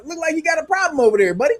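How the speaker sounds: high, mock-coaxing talk to a child; condescending America. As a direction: coax like talking down to a kid. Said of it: "what America is telling Mexico".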